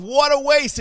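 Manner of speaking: whining Judas. Retold or imitated. Judas grumbling about the wasted oil, mock whining. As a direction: play Judas griping, hammed-up. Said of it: "he's thinking".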